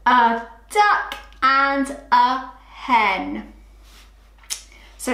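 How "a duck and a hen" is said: slow, every word spaced; slow reading together. Shown as a demonstration. Teacher to class, slow shared reading of sentence. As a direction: read it together slowly. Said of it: "let's read it together".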